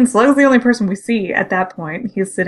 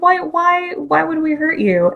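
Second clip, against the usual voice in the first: high, pleading repeated why; incredulous high plea; 8 semitones higher than usual. Retold or imitated. Windblade asks the scared bug why they'd hurt him, high and pleading. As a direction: plead high as if he can't believe it.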